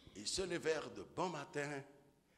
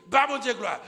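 glory cry, much higher and louder; praise shout; 10 semitones higher, 17 dB louder than usual. Acted. The mother praising God, loud shout.

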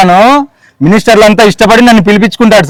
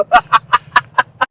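quiet chuckle after the joke; a soft amused laugh; 6 dB quieter than usual. Real, not acted. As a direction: laugh off the mix-up softly. Laughing at the mix-up.

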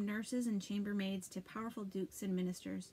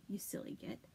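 under the breath, unvoiced; whispered jab, voiceless; whispered insult; 7 dB quieter than usual. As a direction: hiss the insult aside. Muttered insult.

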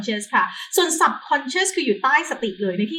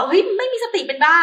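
mocking exclamation; 9 semitones higher, 5 dB louder than usual. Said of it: "ไม่มีสติไม่ใช่แบบว่า".

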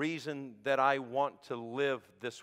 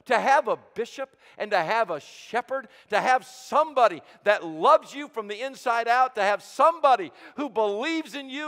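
high, loud, impassioned sermon delivery; full-force preaching; 9 semitones higher, 11 dB louder than usual. Real, not acted. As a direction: swell with conviction and punch the key words.

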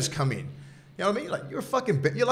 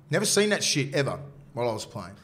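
ordinary talk, nothing marked

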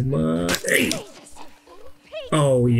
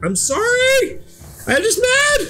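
very high-pitched squealed protest; mock-distressed apology; slower than usual. Player apologizing to critics for insulting Ellie, high mock wail. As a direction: plead your excuse in a squeaky, exaggerated high voice. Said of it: "I'm like".

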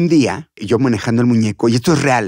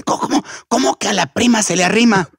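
stammering, scandalized higher voice; acted outrage; 7 semitones higher than usual. Angry host repeating the puppeteer's joke back, scandalized and high. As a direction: imitate an incredulous telling-off. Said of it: "cuando se enojaba".

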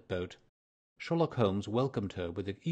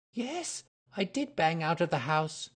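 put-on higher, earnest young woman's voice; nervous young lady; 7 semitones higher than usual. A narrator's reading. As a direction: voice her as an eager, flustered client. Mary Sutherland voiced by narrator.

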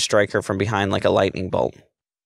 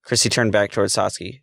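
ordinary delivery

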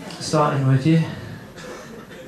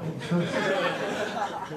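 slow, much softer words dissolving into laughter; laughing disbelief; 5 semitones higher, 10 dB quieter, much slower than usual. Real, not acted. Telling the crowd about the strange voice, laughing softly. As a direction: let the laugh swallow the question. Reacting to mate's odd voice.

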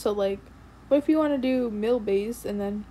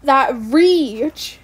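outraged; 2 semitones higher, 10 dB louder than usual. Real, not acted. Outraged at hitbox.

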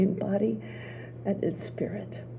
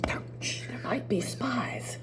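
hushed warning, unvoiced; whisper; 12 dB quieter, slower than usual. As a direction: whisper so no spies hear.

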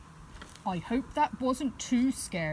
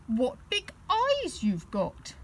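little bear, wondering; 6 semitones higher, slower than usual. Acted. Teddy wonders at Grandma.

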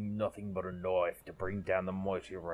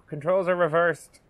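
panicked; 9 semitones higher, 10 dB louder than usual. Real, not acted. Panicking mid-flight.

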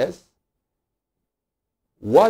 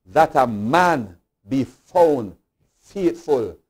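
normal talking voice

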